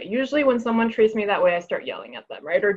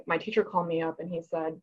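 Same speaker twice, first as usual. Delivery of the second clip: ordinary delivery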